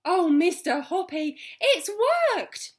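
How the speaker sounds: high and loud, bursting with joy; excited cry. Acted. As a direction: cry it out, overjoyed. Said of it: "she was crying".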